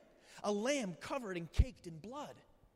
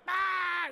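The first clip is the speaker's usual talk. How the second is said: high, loud shocked cry of "ah"; shocked yelp; 9 semitones higher, 6 dB louder than usual. Retold or imitated. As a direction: let out a startled yell. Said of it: "My wife and I are like".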